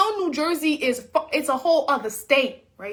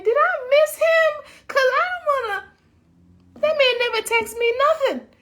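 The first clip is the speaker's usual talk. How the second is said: panicked; 10 semitones higher than usual. Retold or imitated. Checking her phone in panic, high alarmed voice. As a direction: sound thrown, pitched high. Panicking over missed interview.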